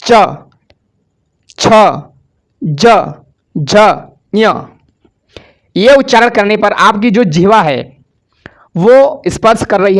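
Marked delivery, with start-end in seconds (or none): 0.0-4.5 each sound च, छ, ज, झ, ञ spoken alone with pauses; teacher sounds out च, छ, ज, झ, ञ so students copy; slow recital of the letters. Shown as a demonstration. Teacher asks students to repeat च, छ, ज, झ, ञ, sounding each out. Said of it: "आप लोग हमारे साथ उच्चारण करेंगे".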